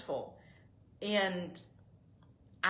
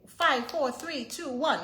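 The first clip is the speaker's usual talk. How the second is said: loud, high countdown, each number hit hard; stern countdown; 7 semitones higher, 8 dB louder, slower than usual. Retold or imitated. Mother counting down to make her daughter appear, loud and stern. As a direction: count down like mommy means business. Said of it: "I say".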